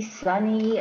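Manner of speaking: ordinary delivery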